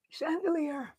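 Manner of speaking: soft unvoiced whisper of wonder; awed whisper. Real, not acted. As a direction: breathe the word in awe. Admiring a find.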